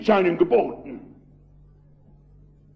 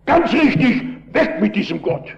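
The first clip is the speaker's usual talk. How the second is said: shouting agreement; 6 semitones higher than usual. Retold or imitated. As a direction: shout back "weg mit diesem Gott!" to the crowd. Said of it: "da hab ich geschrien".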